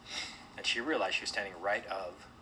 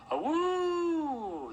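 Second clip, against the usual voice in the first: high, long imitated wolf howl; loud, rising "awoo" held high; 5 dB louder than usual. A narrator's reading. Wolves howl in the distance, high drawn-out howl. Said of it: "the howls made Mona jump".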